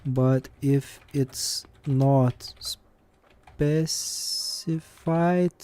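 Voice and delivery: ordinary talk, nothing marked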